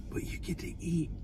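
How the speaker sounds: hushed whisper. Real, not acted. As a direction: lean in and whisper. Dramatic aside.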